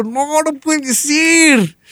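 very high, drawn-out reenacted squeal; hammed-up astonishment, soaring pitch; overjoyed disbelief. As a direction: stretch it out in a squeaky, amazed high voice. Acted disbelief at great news.

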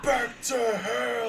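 high, slow dramatic yell; dramatic shout. Acted. The doctor banishes the cow to hell, high slow shout. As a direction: bellow the banishment.

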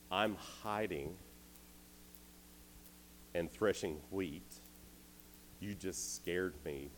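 slow, spelled-out protest with long pauses; incredulous objection. Retold or imitated. Gideon objecting to the angel's call, slow incredulous protest. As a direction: lay it out haltingly for a mistaken angel.

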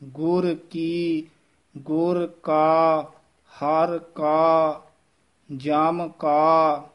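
ਗੁਰ ਕੀ said slowly, each word apart; very slow, separated. Shown as a demonstration. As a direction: drag out each word pair. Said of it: "ਇਹਨਾਂ ਨੂੰ ਵੱਖਰਾ ਪੜ੍ਹਨਾ ਹੈ".

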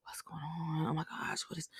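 hushed whisper of complaints; muttering under breath. Retold or imitated. Shift leader grumbling under his breath nearby, whispered. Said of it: "saying, like, little stuff under his breath".

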